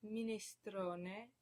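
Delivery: minestrone with "stro" drawn out; penultimate stress. Shown as a demonstration. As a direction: lean on the second-to-last syllable of minestrone. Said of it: "stress on the second to last syllable".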